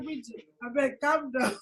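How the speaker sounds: soft, calming plea; soothing. Retold or imitated. Calming a tearful Bridget on the phone, softly. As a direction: settle her down gently. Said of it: "I said".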